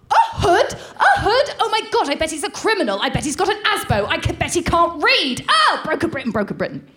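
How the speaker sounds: high, incredulous hammed-up horror; mock-outraged friend. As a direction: overdo the snobbish shock, then sink at the end. Imitates outraged friend reacting.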